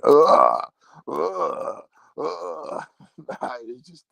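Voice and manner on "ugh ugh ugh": mock grunting.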